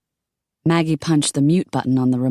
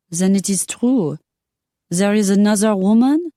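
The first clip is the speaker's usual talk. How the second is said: put-on an offended Parisian lady with a French accent; 7 semitones higher than usual. Simone again, French accent.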